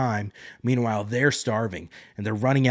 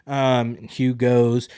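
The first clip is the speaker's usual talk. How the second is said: usual speaking voice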